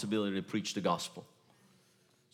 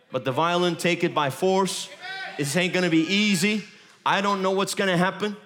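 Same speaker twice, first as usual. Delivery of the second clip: raised, driving preacher's voice; fiery preaching; 5 semitones higher, 5 dB louder than usual. Acted. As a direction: preach it hard to the congregation. Preaching at full force.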